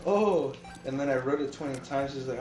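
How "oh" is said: startled oh. Retold or imitated. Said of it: "I'm like".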